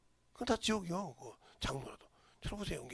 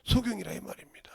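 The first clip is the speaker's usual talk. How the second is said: normal talking voice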